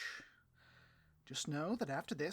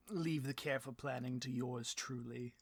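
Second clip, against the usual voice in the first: ordinary delivery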